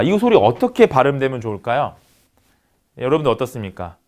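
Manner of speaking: ordinary talk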